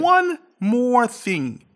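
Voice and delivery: Columbo. Pretend. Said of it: "if I may pull a Columbo".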